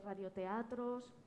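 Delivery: usual speaking voice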